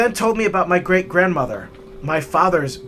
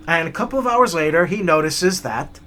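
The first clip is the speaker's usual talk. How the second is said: ordinary delivery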